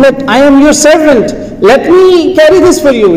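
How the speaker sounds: eager offer. Retold or imitated. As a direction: beg to take the load. Servant eagerly offers to carry.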